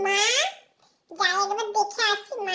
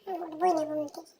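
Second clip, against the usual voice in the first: low, soft, quick grumble; child muttering refusal under breath; sulky mutter; 7 semitones lower, 7 dB quieter, faster than usual. Acted. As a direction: mumble it stubbornly. Child mutters then declares loudly.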